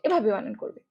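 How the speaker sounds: ordinary talk, nothing marked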